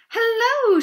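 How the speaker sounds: high, loud drawn-out hello; loud, lilting hello from a visiting sheep; sheep's greeting. A narrator's reading. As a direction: call out a big sing-out greeting. Sheep calls on Wilbur.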